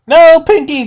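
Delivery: high, loud desperate scream. Acted. Dash screams at Pinkie as the hammer comes down. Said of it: "Dash screamed".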